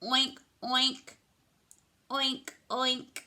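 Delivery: crisp spoken oinks in pairs; pig noises. Acted. Showing a child what noise the pig says, oinking. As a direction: oink like a picture-book pig. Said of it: "What noise does the pig say?".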